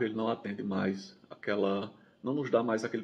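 talking around a cigarette; cigarette in mouth. Real, not acted. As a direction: lips pressed on cigarette. Seen 0: Cigarette held between lips by tattooed hand throughout labelled words.

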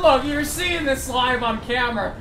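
normal talking voice

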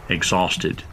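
usual speaking voice

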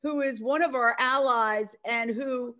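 normal talking voice

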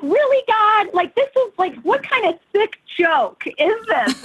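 exasperated, disbelieving cry heavenward; exasperated at God. Retold or imitated. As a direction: throw it up at God in mock outrage. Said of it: "And I was like".

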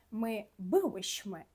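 Polish "я бивем" drawn out slowly, every sound clear; slow Polish demo. Shown as a demonstration. Teacher modelling the Polish form for students, very slowly. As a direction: say "я бивем" slowly for learners to copy. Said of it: "закінчення «вишми»".

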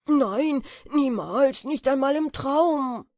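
loud, rapturous cry of delight; jubilant. A narrator's reading. Moth dancing through the attic, loud and jubilant. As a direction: shout out your joy at flying. Moths rejoice while dancing.

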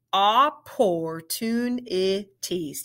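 said slowly, syllable by syllable; slow, careful "Opportunities"; slow model word. Shown as a demonstration. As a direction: stretch out every syllable of "Opportunities". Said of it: "Say it with me".

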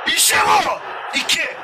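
loud, high burst of joy; goal celebration shout. Real, not acted. Gamer celebrating his goal, shouting with joy.

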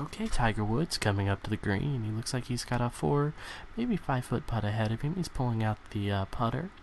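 hushed, hesitant golf commentary; timid golf announcer. Acted. Commentator following Tiger Woods up to the green, timid hush. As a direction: call the putt gently, full of little uhs. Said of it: "I'm a very timid person announcing golf".

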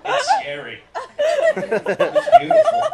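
very high, loud squeal; terrified and thrilled in freefall, squeaking; little girl scream. Retold or imitated. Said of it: "I screamed like a little girl".

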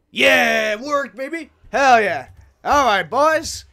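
elated loud cheering; triumphant cheer. Real, not acted. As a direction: celebrate like a win. Portal works.

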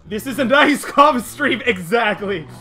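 high, loud outburst; exclaiming loudly. Real, not acted. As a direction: burst out with it to the chat. Exasperated reply to chat.

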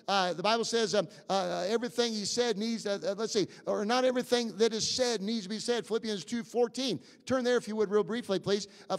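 usual speaking voice

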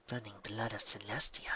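hushed, whispery disbelief; disbelieving oath. A narrator's reading. Mayor swears on seeing Twilight alive, hushed disbelief. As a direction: swear under the breath in astonishment. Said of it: "swore in disbelief".

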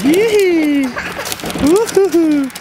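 high loud whoop of joy; whooping cheer. Real, not acted. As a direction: celebrate with a wild whoop. Cheering success.

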